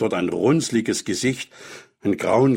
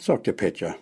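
usual speaking voice

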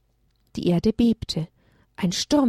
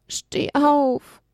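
pleading; 6 semitones higher than usual. Acted. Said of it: "flehte Elia".

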